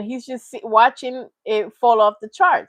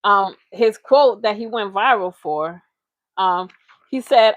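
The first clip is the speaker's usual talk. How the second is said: ordinary talk, nothing marked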